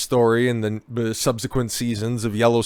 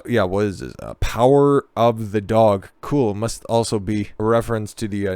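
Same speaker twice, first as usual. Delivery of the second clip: usual speaking voice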